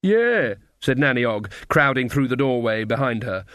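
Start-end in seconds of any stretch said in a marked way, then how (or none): 0.0-0.5 high put-on agreement; beggar act chime-in. A narrator's reading. Friend joins the beggar act.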